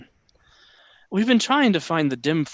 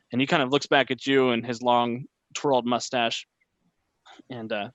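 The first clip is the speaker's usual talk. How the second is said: usual speaking voice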